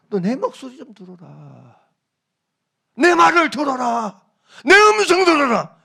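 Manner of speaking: plead, climbing with each line; God pleading. Acted. Voicing God's repeated warning. (백성에게 경고하는 하나님, 점점 고조되며)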